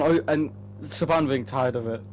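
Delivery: normal talking voice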